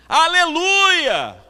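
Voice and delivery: high, loud, drawn-out holler; long belted reply across a distance; shout from upstairs. Retold or imitated. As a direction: call down to someone far below. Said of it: "e gritou de lá do, lá de cima".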